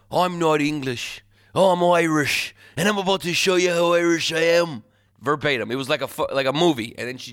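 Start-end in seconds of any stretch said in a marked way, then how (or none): 0.1-4.8 higher, louder mock-Irish outburst; angry Irish accent. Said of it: "and she goes".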